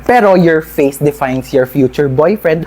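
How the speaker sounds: usual speaking voice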